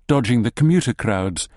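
plain reading voice

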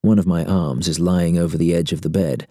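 plain reading voice

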